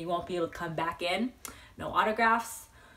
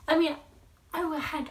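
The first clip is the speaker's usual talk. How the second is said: usual speaking voice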